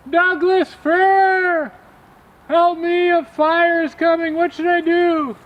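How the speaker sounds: very high, loud slow wail of a tiny mouse; little mouse begging at the top of his voice; desperate mouse cry. A narrator's reading. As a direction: scream up the big tree for rescue. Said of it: "he said".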